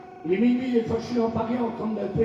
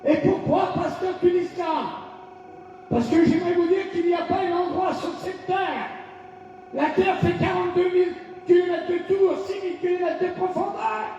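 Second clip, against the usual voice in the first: fervent, rising sermon cry; shouted declarations of faith; full-force preaching; 5 semitones higher than usual. Acted. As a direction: proclaim each line to the congregation.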